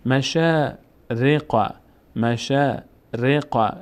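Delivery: مشارق with the long a of شا stretched slowly; slow, chanted مشارق; slow recitation. Shown as a demonstration.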